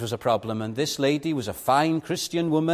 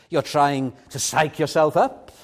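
ordinary talk

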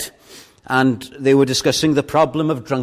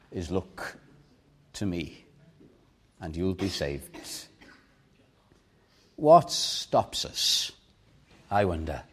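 tender invitation; 2 semitones lower, slower than usual. Acted. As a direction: draw them in gently, almost whispering. Quiet tender altar call.

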